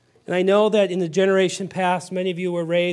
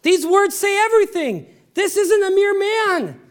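impassioned preaching; 11 semitones higher than usual. Acted. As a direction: preach it with rising fervour. Preaching at full force.

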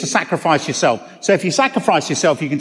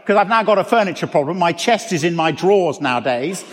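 ordinary talk

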